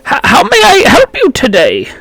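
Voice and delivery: put-on high-pitched, stammering old gnome greeting. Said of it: "he kinda leans over it".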